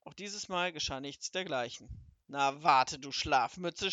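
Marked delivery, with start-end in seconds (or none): 2.3-3.9 low, loud threatening scold; furious wizard scolds the sleepyhead. Acted. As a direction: growl at the lazy servant. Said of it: "schimpfte der große Zauberer".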